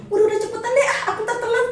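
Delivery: high, hurried shooing him off; impatient brush-off. Retold or imitated. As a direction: wave him away, she's running late. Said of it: "asal ngomong aja gitu". (nada tidak sabar mengusir)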